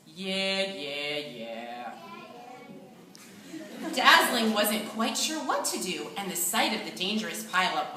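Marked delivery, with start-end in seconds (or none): 0.1-1.7 sinking lower and softer with each yeah; mopey yeahs trailing off into a sung sigh. A narrator's reading. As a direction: drag it out, fading into gloom. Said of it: "said in a very mopey voice".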